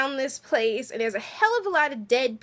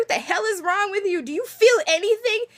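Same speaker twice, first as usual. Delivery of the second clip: pitched-up, exasperated demand; angry attitude; 8 semitones higher, 4 dB louder than usual. Retold or imitated. Beth confronting Daryl about his feelings, higher and louder, exasperated. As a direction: imitate Beth lashing out at Daryl. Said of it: "the attitude is like".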